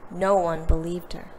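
ordinary delivery, nothing marked

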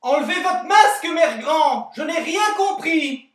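put-on high, loud old-lady cry; grandma calling out. Grandmother shouts at the masked visitor, high and cartoonish. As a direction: play a deaf grandma shouting through the door. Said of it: "lui cria".

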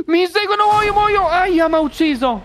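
gasp, then high panicked cries; being chased. Real, not acted. Monsters chasing him, he dies.

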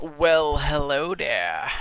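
a loud drawn-out hello to the loot; pleased surprise. Real, not acted. Player spots a rare drop, loud slow pleased greeting.

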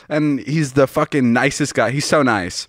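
ordinary delivery

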